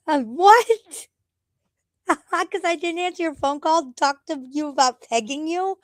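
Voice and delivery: high, incredulous outcry with a laugh; outraged disbelief. Real, not acted.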